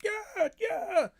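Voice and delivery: high, far-off pumped-up yell; hyped-up cheer. Retold or imitated. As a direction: cheer like a hyped gang before a brawl. Crew pumping themselves up before fight.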